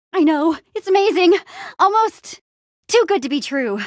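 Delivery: high-pitched, gushing amazement; gush, voice lifted high; thrilled and marveling; gushing excitement. Acted. Gushing over amazing news.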